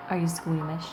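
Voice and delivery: usual speaking voice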